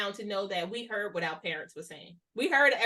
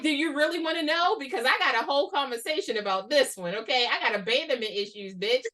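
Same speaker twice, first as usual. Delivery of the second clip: raised, louder comic rant; mock outburst; 3 semitones higher, 4 dB louder than usual. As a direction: unload it with playful defiance. Acted outburst answering who hurt you.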